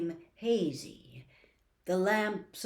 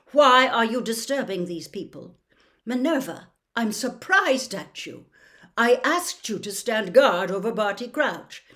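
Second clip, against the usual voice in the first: louder, stern headmaster; stern rebuke; 3 semitones higher, 10 dB louder than usual. Acted. Dumbledore rebuking McGonagall and Fudge, sternly. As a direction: rebuke them with cold authority.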